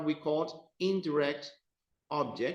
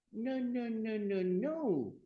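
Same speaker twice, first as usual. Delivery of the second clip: high, softer string of no's, stepping down; playful correction; 8 semitones higher, 4 dB quieter, slower than usual. Pretend. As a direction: cut in with singsong no-no-no. Corrects the imagined student's mistake.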